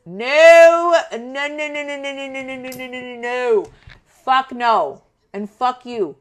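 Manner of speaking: a sharp no, then a rapid string of no's; emphatic refusal. Retold or imitated. Telling a family member no, loud and final. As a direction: shut them down hard, no after no. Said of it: "I'm like".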